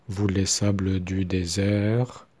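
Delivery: slowly, each syllable spaced; slow demonstration of the refrain; slow refrain demo. Shown as a demonstration. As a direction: lay out the refrain line evenly. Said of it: "on ne prononce pas le E".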